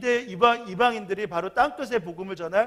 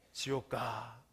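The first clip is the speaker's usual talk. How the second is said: a low, soft, stern sentence delivered slowly; stern verdict; 7 semitones lower, 12 dB quieter, much slower than usual. Retold or imitated. God condemning the young man to hell, low and soft. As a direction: hand down the punishment quietly and coldly. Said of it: "화를 내요".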